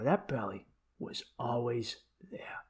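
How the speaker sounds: slow hushed whisper. Retold or imitated. Telling a new girlfriend his belly was always there, slow whisper. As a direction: whisper it like a sly secret.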